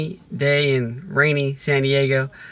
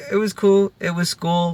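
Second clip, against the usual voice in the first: put-on bored kid answer; 5 semitones higher than usual. Imitating a kid brushing off a parent, mock bored. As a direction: toss it off like a kid who can't be bothered.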